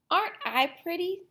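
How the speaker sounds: put-on high, fast preening old lady; vain Granny. Vain Granny admiring herself, high and quick.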